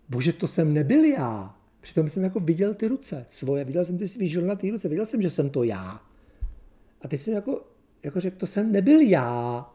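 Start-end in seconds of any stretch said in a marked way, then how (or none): 0.1-1.6 drawn-out protest with a raised swell; retold cry to God. Preacher retelling his cry to God, drawn-out protest. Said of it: "Tak jsem začal křičet". 8.4-9.7 higher, louder, drawn-out denial; retold protesting cry. Preacher reliving his denial before God, raised drawn-out cry. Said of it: "Tak jsem začal křičet".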